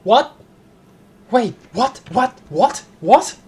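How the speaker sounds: high, loud, baffled yelps of what; startled repeated what; stunned what. Real, not acted. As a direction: keep blurting what in disbelief. Baffled by the strange phone call.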